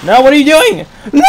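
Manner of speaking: high, loud yell into a laugh; panicked no. Real, not acted. Gamer yelling at teammate, panicked shout. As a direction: shout no and crack up.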